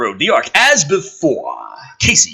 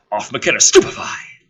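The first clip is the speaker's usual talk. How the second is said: ordinary talk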